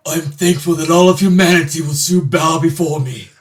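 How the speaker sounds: loud, high villain proclaiming; Vegeta. Acted. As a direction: boast like an arrogant dark lord. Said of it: "should we ask Vegeta what he's thankful for?".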